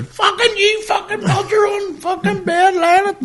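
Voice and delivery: high, loud, slow yelled rant; hammed-up shout at his wife in the retold row; mock furious shout. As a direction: bellow it out, dragging each word.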